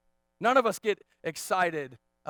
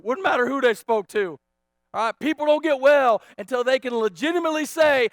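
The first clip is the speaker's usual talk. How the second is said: loud, raised preacher's delivery; full-force preaching; 6 semitones higher, 12 dB louder than usual. Real, not acted. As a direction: preach it out, higher and louder.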